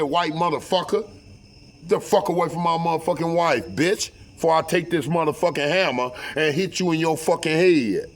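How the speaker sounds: heated, high-pitched threat, hitting the last word hard; retold furious warning; angry threat. Threatens man with a hammer.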